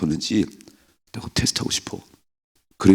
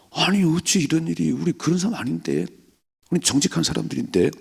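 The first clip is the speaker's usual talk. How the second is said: shocked protest; 6 semitones higher than usual. Retold or imitated. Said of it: "깜짝 놀랄 거잖아요".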